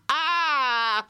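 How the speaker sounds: very high, shouting laughter at full force; shrieking laugh. Shown as a demonstration. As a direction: laugh as loud as you can. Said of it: "And as loud as you can".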